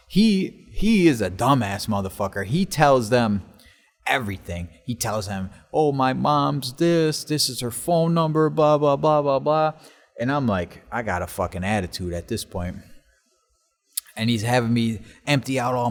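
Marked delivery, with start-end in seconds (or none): 5.7-9.7 high, slow mimicry of a friend; mocking blabbermouth. Friend tells guard everything, mocking blabbermouth. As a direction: rattle off details in a raised, drawn-out mocking voice.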